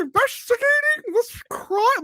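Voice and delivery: put-on squeaky overdone parody of the accent; mock Gillian Anderson accent. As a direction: ham up her strange film accent. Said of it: "I don't know what accent that was".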